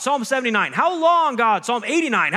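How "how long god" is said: high, loud desperate yell; yelling at God. Shown as a demonstration. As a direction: throw the question up at heaven. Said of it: "teaches us to yell at God".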